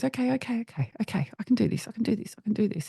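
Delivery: high-pitched, rapid self-reassurance, mildly panicked; panicked child. Retold or imitated. Daughter giving in to mother, high and mildly panicked. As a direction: retell a frightened child talking herself into it.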